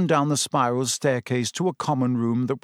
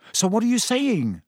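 high-pitched, tense question; 9 semitones higher than usual. A narrator's reading. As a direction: ask uneasily, pitched well up. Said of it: "looking very tense".

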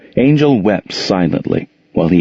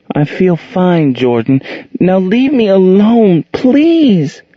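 put-on girl begging her stepfather to leave; scared little girl; 12 semitones higher, slower than usual. A narrator's reading. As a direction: push him away, voice rising in fear. Angel voiced high, scared.

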